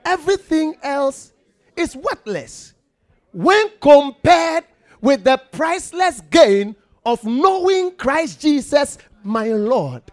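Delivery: high, loud preaching read; loud scripture proclamation. Acted. Preacher to congregation, loud proclamation. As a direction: declare it to the church.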